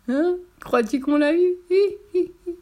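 high, slow, squeaky gloating; tittering character voice; giggling potato. A narrator's reading. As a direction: squeal with barely held laughter. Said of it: "qui n'y tenait plus".

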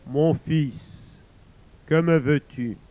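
loud, booming divine voice; voice of God. Acted. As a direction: boom down from the heavens. God speaks from above.

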